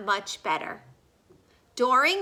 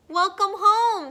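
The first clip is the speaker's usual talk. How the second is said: loud, high booming welcome from dad; father calls a hearty welcome home; father's greeting; 10 semitones higher, 9 dB louder than usual. A narrator's reading. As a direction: boom it out like a proud father. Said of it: "said her father".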